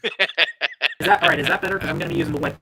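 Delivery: distorted, glitchy mic audio; scrambled voice. Real, not acted. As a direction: voice crackling and dropping out. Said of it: "This is even worse".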